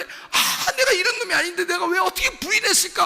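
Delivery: very high, loud tearful wail; anguished Peter. Acted. Peter reproaching himself for the denial, anguished wail. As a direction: cry out in tormented self-reproach. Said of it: "막 괴로워하다가".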